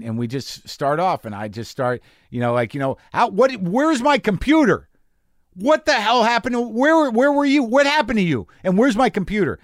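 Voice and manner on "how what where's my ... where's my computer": higher, louder acted outrage; improv yelling. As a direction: demand the missing computer in a staged rage. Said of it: "I'm yelling".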